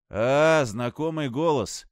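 loud glad cry, then slow; surprised greeting. Acted. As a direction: call out in surprised recognition. Injured pilot recognizes dispatcher. (радостное удивление)

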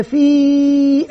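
في drawn out as a separate syllable; teacher spelling aloud. Shown as a demonstration. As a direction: sound out في slowly for the class. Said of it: "يبدأ المعلم يتهجى".

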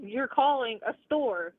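ordinary talk, nothing marked

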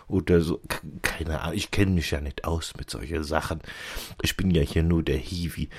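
normal talking voice

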